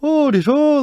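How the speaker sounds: high, squeaky mock Irish brogue; mock Irish accent. Cousin mocking Irish guys, hammed-up Irish accent. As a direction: drunk cousin hamming up a fake Irish accent. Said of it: "like, doing that".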